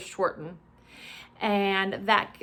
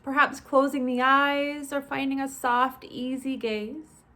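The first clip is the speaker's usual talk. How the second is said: measured, spacious body-scan instruction; guided meditation. Acted. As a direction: ease listeners through each step, stretching the phrase endings.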